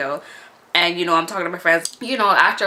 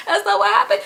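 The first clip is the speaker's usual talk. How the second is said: ordinary talk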